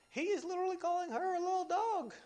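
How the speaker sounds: high, fast, shocked emphasis; indignant emphasis. Real, not acted. Preacher stressing the insult to the congregation, shocked and high. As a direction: say it like you can't believe it. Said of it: "preacher's indignant emphasis".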